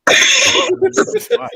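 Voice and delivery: high-pitched laugh. Real, not acted. Amused at being threatened.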